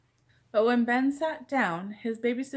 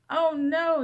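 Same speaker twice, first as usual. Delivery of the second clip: high loud cry of alarm; alarmed yell; 7 semitones higher, 6 dB louder than usual. Acted. Mother yells in alarm on hearing the news. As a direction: shout it in shock. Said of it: "yelled the mother".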